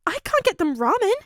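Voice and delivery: put-on high, bright nervous boy; eager young boy voice. A narrator's reading. Midoriya protests.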